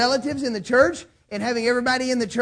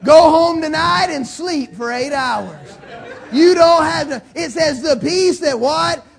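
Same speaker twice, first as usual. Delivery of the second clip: slow, louder and louder with a laugh; preaching at full force; 6 dB louder, slower than usual. Real, not acted. Pastor urging his church to rest, building to a shout. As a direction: build to a ringing call.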